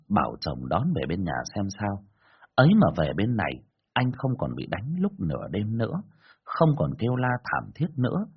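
usual speaking voice

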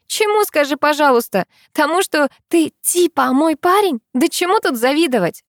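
high-pitched, scoffing questions; scornful incredulity. A narrator's reading. Girl mocking the driver's claim of being envied, scornfully incredulous. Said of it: "не унимается она".

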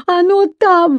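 put-on high, loud child's outcry; exclaiming. A narrator's reading. Еля insisting to her parents, exclaiming. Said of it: "воскликнула Еля".

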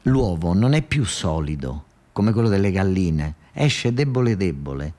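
ordinary delivery

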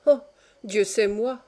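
put-on understated old man's grumble; grumbling. A narrator's reading. Dying old man answers his son, grumbling. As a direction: grumble it lightly. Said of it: "reprit le vieillard en grommelant".

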